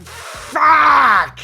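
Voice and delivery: high, loud angry yell; frustrated shout. Real, not acted. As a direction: explode in frustration. Frustrated outburst.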